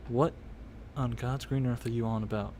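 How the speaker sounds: low, hushed bartender's warning; a hint of a threatening barkeep; lightly voiced bartender, stern. Game master plays bartender.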